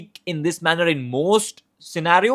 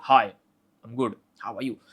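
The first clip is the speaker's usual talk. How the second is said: calm, dominant, means business; authoritative tone; 4 semitones lower than usual. Shown as a demonstration. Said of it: "Second is completely authoritative".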